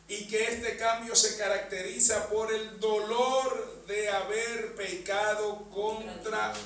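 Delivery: ordinary talk, nothing marked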